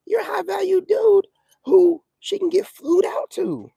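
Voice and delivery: high, slow, mocking tone; mock high value dude. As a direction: draw it out high in mockery.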